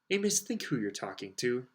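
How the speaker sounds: usual speaking voice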